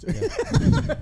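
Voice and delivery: high squeal of laughter; laughing. Real, not acted. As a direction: crack up mid-word. Laughing at the joke.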